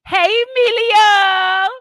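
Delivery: high, loud mock cry of delight; a sweet overdone greeting across the room; gushing greeting. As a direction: gush hello like spotting an old friend.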